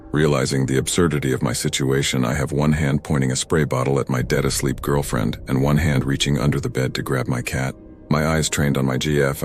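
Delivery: plain reading voice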